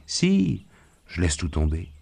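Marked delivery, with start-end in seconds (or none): 0.1-0.7 insistent fright. A narrator's reading. Wife insists during shooting.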